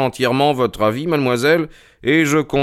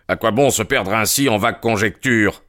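plain reading voice

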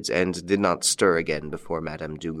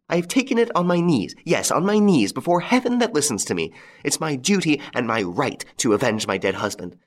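higher, vowing widow voice, relishing cruelty; vengeful widow; 6 semitones higher than usual. A narrator's reading. Widow gloating over Lupin, vowing to avenge her husband. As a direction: swear the oath of revenge, gloating.

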